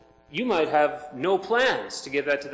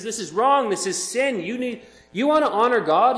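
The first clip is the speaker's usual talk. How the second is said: stern rebuke; 8 semitones higher, 7 dB louder than usual. Retold or imitated. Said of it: "He says".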